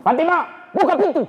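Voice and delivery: high shouted demand at the door; angry call. Acted. Umar demands his sister open the door, high shout. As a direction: bang and yell for her to open.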